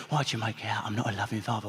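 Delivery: gruff dad. Retold or imitated. Gruff dad voiced in story.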